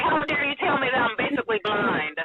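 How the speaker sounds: high, hammed-up how dare you; playfully outraged retort; mock indignation. Wife half-jokingly indignant at husband's advice, mock outrage. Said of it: "I wanted to be mad".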